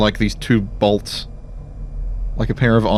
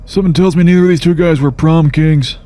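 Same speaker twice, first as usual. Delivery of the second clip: snarky; 7 semitones higher, 4 dB louder than usual. Acted. In-character snark.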